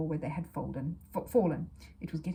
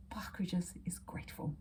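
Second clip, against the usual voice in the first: whispered solemn pronouncement; manager's hushed proclamation; 8 dB quieter than usual. A narrator's reading. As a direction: hush it like a grand announcement.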